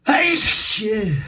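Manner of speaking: sudden high, loud sneeze-curse; sneezing. Real, not acted. Sneeze bursting mid-word.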